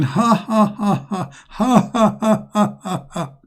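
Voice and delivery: high, staccato fake "ha ha"; Satan's mock laugh. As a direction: imitate a gloating devil's laugh, fading at the end. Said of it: "he starts saying".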